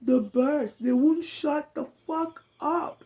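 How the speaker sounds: high, strained, slow complaint through tears; high pained wail about the birds; crying in pain. Retold or imitated. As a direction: beg for the noise to stop.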